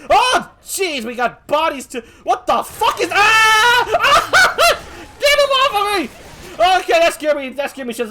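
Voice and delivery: high, loud shrieks of fright; startled yelling that peaks in screams; panicked screaming. Acted. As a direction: shout as if being grabbed. Jump scare, attacked by zombie.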